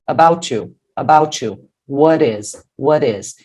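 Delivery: "about you" as "abou-tchu", "what is" as "wha-rez"; linked words. Shown as a demonstration. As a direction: drill "about you" and "what is", each run together as one word. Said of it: "E aqui são os links". ("about you" e "what is" emendados, com tchu e R)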